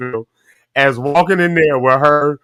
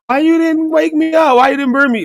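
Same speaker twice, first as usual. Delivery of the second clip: high-pitched imitated complaint; mock wife complaint; 12 semitones higher than usual. Husband imitating his wife who slept through being woken, mock complaining.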